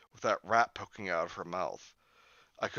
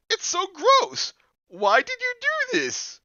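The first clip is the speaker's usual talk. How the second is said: disgusted shriek; 12 dB louder, slower than usual. Acted. Julie disgusted, screaming.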